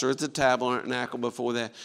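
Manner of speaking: ordinary talk, nothing marked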